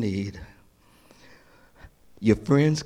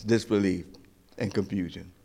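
ordinary delivery

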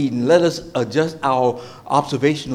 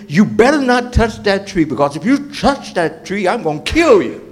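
hammed-up angry threat, pitched up; mock anger; 5 semitones higher than usual. Preacher shows how God was not talking to Adam, mock angry threat. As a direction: imitate a furious warning, punching the words. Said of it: "he wasn't saying it out of anger".

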